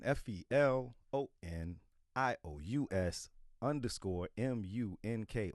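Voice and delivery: spelled slowly, letter by letter. Shown as a demonstration. Promoting his handle to listeners, spelling it slowly. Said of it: "Spell it again".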